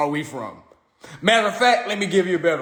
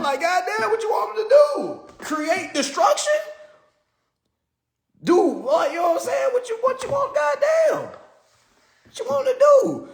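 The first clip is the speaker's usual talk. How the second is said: exasperated outburst. Retold or imitated. Answering the critics as a fed-up King, exasperated shouting. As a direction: throw up your hands in disbelief.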